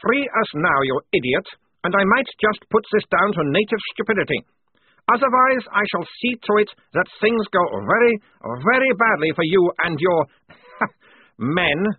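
high, loud, menacing captain; snapped threat. A narrator's reading. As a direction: threaten them with haughty anger. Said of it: "he snapped".

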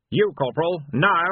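rising command ending in a loud now; barked order. A narrator's reading. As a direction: bark it, no arguments. Captain barks order at Colon.

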